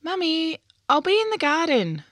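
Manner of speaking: put-on bright, high child's voice calling out to mum. A narrator's reading. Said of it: "Violet called through the back door".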